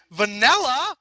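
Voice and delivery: loud, high outraged screech. A narrator's reading. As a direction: shriek the name back. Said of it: "Vinyl screeched".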